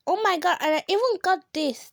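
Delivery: ordinary talk, nothing marked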